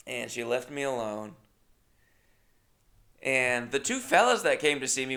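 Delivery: ordinary talk, nothing marked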